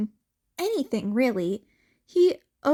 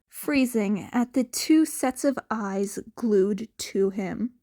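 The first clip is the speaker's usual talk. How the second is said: usual speaking voice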